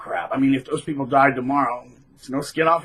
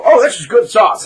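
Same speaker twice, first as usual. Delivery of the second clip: high, loud show-off; hammed-up attention seeker; mock loud diner; 7 semitones higher, 8 dB louder than usual. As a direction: announce it to the whole restaurant. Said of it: "this guy would sit here and go".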